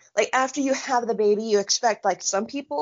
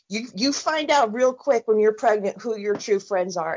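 ordinary talk

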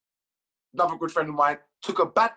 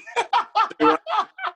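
high loud laughter; 5 dB louder than usual. Real, not acted. Laughs at his own story.

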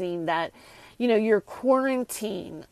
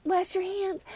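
mocking celebrities; 9 semitones higher, 6 dB quieter than usual. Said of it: "all these celebrities".

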